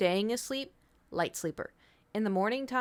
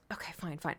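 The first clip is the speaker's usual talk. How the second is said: whisper; 3 semitones lower, 10 dB quieter than usual. Retold or imitated. Giving in to cat.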